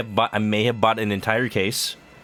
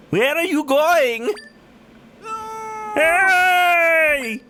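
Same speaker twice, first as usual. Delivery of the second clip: character calling out; slower than usual. Acted. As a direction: shout after the fleeing brother in a squeaky voice. Conde calling brother back.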